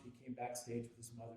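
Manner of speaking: usual speaking voice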